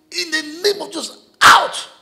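high, loud command, rising to a final bark; rebuking shout. Retold or imitated. Preacher reenacting rebuking the spirit, loud shout. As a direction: cast it out with full force. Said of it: "I said".

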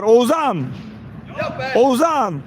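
ordinary talk, nothing marked

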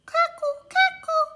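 high sing-song cuckoo; high bird call. Acted. Bird call in read-aloud story.

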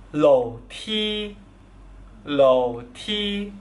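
Mandarin tones on "Lou ti": second, then first. Shown as a demonstration. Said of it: "Lou nada dua".

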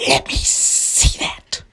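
slow whispered stick-up threat; menacing whispered villain voice. Acted. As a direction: whisper it like a sneaky snake robber. Serpent grabs the note.

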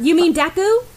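snatch the words before he can; eager cut-in. Acted. Ochako eagerly jumps in.

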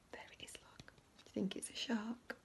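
hushed asking whisper. Acted. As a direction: whisper the question. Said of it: "whispering aside to children".

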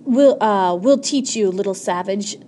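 loud, angry burst, easing off; heated threat, forceful at the start; spitting words out. A narrator's reading. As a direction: spit it out between blows. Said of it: "spitting words out between the hard thwacks".

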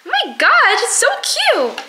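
high squealing gush; excited gush. Real, not acted. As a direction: squeal over something cute. Gushing over bookmarks.

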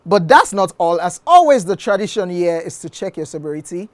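loud, high show-host announcement; announcer voice. Acted. Host announcing the end of the session to viewers.

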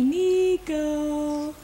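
loud, drawn-out call of the name; loud sing-song name call for the baby. Shown as a demonstration. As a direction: call the brother's name to catch the baby's ear.

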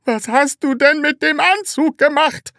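loud, very high horrified cry. A narrator's reading. Seller sees the torn suit, horrified. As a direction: shriek it in dismay. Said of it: "fragte der Verkäufer entsetzt".

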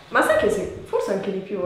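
ordinary talk

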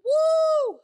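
loud, high-pitched woo; drawn-out party woo; high whoop. Real, not acted. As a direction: let out a big whoop. Cheer opening the sponsor read.

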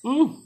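high delighted hum of taste; pleased hum. Real, not acted. Savoring a bite.

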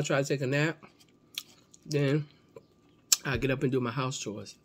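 chewing while talking; talking between bites; mouth full. Real, not acted. Seen 2: holds fried pieces, bites at 9-10 and 15-17 while talking.

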